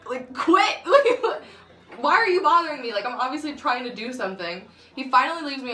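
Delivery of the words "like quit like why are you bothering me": high, loud, laughing protest; laughing annoyance. Real, not acted. Telling the boyfriend to quit, laughing and loud.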